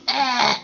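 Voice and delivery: short acted choking cough. Brother caught in Gordon's steam, coughing.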